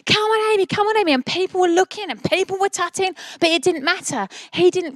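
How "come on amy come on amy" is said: high-pitched imitation of a cheering shout; retold shouting. Dad cheering his daughter through the whole race, mock shouting. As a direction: urge her on in a raised, high voice. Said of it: "he shouted at her".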